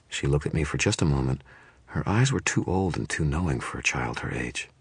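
ordinary delivery

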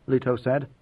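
ordinary delivery, nothing marked

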